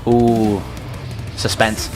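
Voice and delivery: usual speaking voice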